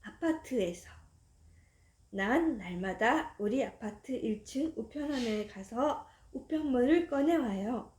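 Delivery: plain reading voice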